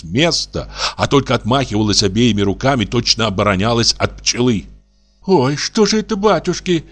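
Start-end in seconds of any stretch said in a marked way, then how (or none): 5.3-6.9 put-on much higher frightened old woman; aghast, hands flailing; terrified mumbling. A narrator's reading. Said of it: "бормотала она в ужасе". (гораздо выше, испуганное бормотание)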